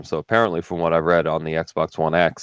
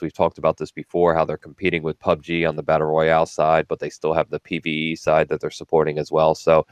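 ordinary talk, nothing marked

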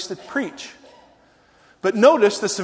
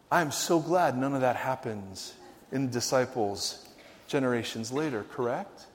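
much lower, hushed deadpan; dry irony; 6 semitones lower, 10 dB quieter than usual. Real, not acted. Preacher to congregation about later disciples, dryly ironic. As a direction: drop down into a wry, quiet aside.

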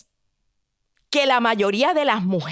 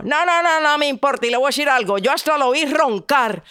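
scolding prince; 3 semitones higher, 4 dB louder, faster than usual. Acted.

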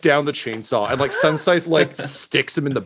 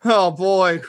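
high, hammed-up existential despair; mock despair; 9 semitones higher than usual. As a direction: wail that your life is a lie. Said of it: "He's like".

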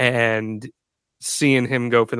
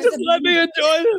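high-pitched, rushed tearful plea; mock crying; faster than usual. Imitating a crying fan at the match, high mock sob. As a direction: imitate a weepy fan pleading to be left alone. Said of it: "like, crying".

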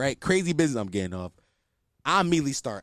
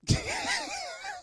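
high-pitched laugh; 5 dB quieter than usual. Real, not acted. Laughs at himself.

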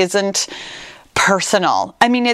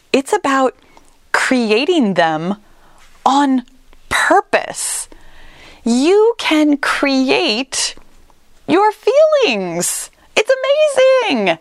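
excited; 6 semitones higher, slower than usual. Real, not acted. Coach marvelling at creating feelings.

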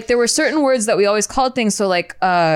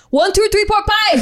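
high mock Filipino accent, counting emphatically; exaggerated Filipino grandma accent, much higher; grandma's Filipino accent; 10 semitones higher than usual. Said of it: "She plays Scrabble in her accent".

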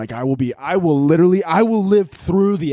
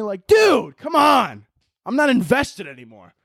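exasperated; 6 semitones higher, slower than usual. Real, not acted. Frustrated outburst at the dub.

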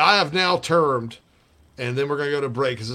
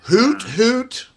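owl hoot; 8 semitones higher, 6 dB louder than usual. Pretend. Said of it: "the Owl Shat offense".

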